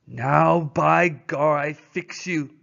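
loud, angry French-Canadian threat; angry Francois threatening Buck, loud accented cry. Acted. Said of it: "he cried".

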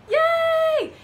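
high, loud birthday yay; gleeful high-pitched whoop; excited cheer. Acted. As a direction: cheer it out for young listeners. Reader cheers Cowboy Roy's birthday.

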